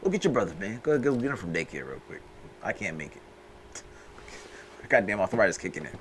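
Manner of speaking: put-on tired old man voice. Old father sending son.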